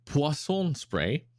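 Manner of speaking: slow, French-accented Poisson Spray. Shown as a demonstration. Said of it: "I say it with a French accent".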